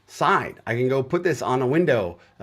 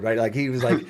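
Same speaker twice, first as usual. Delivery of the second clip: usual speaking voice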